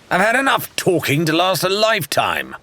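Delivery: exploding. A narrator's reading. Rathbone bursting out at Shan over endless talk, angry. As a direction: blow up, fed up with talk. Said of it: "Rathbone exploded".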